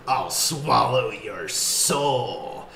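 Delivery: very slow, drawn-out demonic line; imitating the possessed Ellie's slow threat; Deadite voice. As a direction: stretch every word like something possessed. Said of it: "her voice, uh, they alter it".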